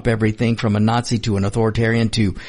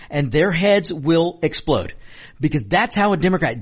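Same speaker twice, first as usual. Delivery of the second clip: ordinary talk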